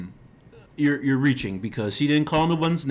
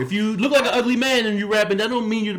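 higher mocking voice of a boasting rapper; 6 semitones higher than usual. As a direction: puff it up as her brag. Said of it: "She's saying".

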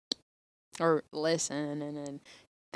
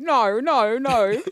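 loud, high mock-Australian no's; hammed-up Australian "no, no, no"; Australian accent; 8 semitones higher, 11 dB louder, much slower than usual. Co-host mimics Australians saying no, hammed-up Australian accent. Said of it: "Australian people be like".

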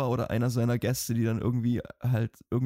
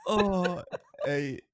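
after laughing hard; 6 semitones higher, much slower than usual. Real, not acted.

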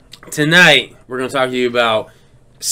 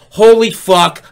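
mock alarmed outburst; 5 semitones higher, 7 dB louder, slower than usual. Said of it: "The first thought was".

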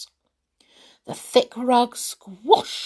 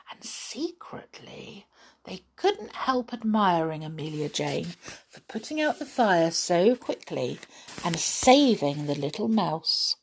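usual speaking voice

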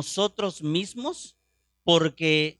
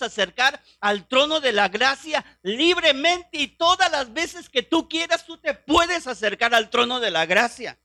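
higher, louder fervent preacher; full-force preaching; 6 semitones higher, 4 dB louder than usual. Acted. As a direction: proclaim it to the congregation with rising fervor. Predica con toda la fuerza. (predicación ferviente, más alta y fuerte)

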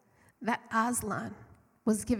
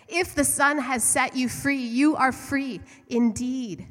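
slow, louder proclaiming of the verse; scripture quote; 4 semitones higher, 7 dB louder, slower than usual. Acted. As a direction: read it out as a solemn promise. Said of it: "There are scriptures that say".

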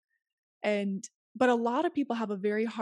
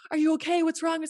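put-on concerned boyfriend; 6 semitones higher, 5 dB louder than usual. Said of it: "he's like".

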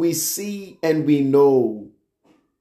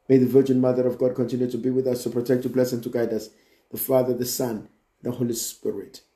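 closing prayer; 3 semitones lower, much faster than usual. Real, not acted. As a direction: run through a familiar closing prayer, voice dropping to the Amen.

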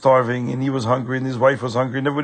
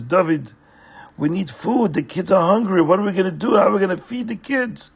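higher, anxious pleading; worried wife; 6 semitones higher, 3 dB louder than usual. A narrator's reading. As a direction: fret over the hungry kids. Wife pleads, kids hungry.

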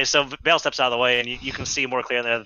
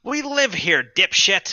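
rude squatter; 9 semitones higher, much slower than usual. Acted. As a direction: scoff at the intruder. NPC insults player.

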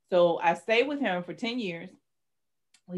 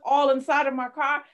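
usual speaking voice

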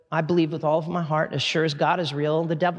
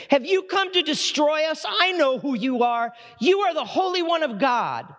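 higher, taunting demon cry; mock demon voice; 8 semitones higher than usual. Preacher voicing the demon confronting Jesus, taunting and higher. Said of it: "Listen to what the demon says".

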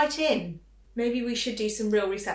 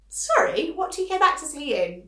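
incredulous; 8 semitones higher, 7 dB louder than usual. Real, not acted. Incredulous at prince's shopping claim.

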